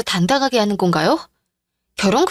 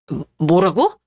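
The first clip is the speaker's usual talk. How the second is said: shocked stammer; 5 semitones lower, slower than usual. Acted. Husband shocked by video.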